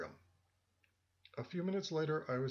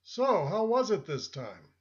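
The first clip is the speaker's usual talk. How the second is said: louder, slower friendly greeting with a higher voice; friendly call; 4 semitones higher, 9 dB louder than usual. A narrator's reading. Stranger calling out kindly to the resting narrator. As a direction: call it out warmly and unhurried. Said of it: "he called out in a friendly tone".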